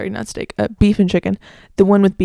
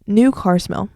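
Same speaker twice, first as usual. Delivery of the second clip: ordinary talk, nothing marked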